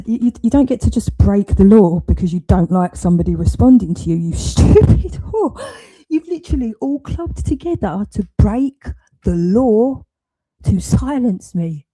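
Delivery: loud, heated telling-off; real furious tirade. Real, not acted. Berating someone for breaking the law to silence her, furious. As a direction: lay into them at full volume.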